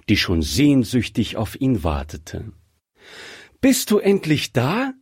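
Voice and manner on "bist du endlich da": high, glad greeting question; cheerful call. A narrator's reading. Young bride greeting her fiancé at the door, cheerful. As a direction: happily call out in a higher voice. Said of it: "rief sie fröhlich".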